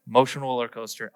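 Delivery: ordinary talk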